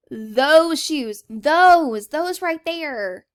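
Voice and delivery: THOSE drawn out high and loud; emphatic pointing. Acted. Answering which shoes are amazing, emphatic on "Those".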